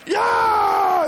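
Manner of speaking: yeah shouted very high; joyful yell. Acted. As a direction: cheer like a winner. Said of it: "Overjoyed is like".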